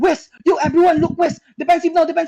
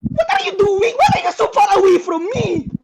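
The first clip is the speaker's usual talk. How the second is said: frustrated yell; 5 semitones higher than usual. Real, not acted. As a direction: yell at them for running away. Team ran off, yelling in frustration.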